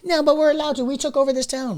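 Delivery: high-pitched entitled protest, imitated; mock-indignant townsperson. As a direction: mimic a complainer insisting, voice way up. Mimicking entitled Seattle residents.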